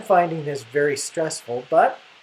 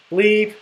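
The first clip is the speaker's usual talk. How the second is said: a quick, higher mock bleep over the swear word; spoken censor bleep; 5 semitones higher, 5 dB louder than usual. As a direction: play the TV censor, pop out "bleep". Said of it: "I will be our official censor".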